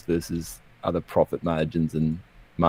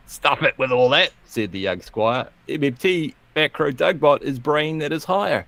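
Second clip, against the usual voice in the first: higher, swinging comic verse; poem recital; 6 semitones higher than usual. Acted. Young squire storms onto the stage, declaimed as comic verse. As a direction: declaim it with big pitch lifts on the rhymes.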